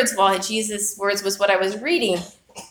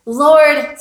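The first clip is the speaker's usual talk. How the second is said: a higher, louder cry of Lord; 6 semitones higher, 9 dB louder than usual. Retold or imitated. Peter sinking in the storm, calling out to the Lord, a loud plea. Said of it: "He cries out like so many of us do".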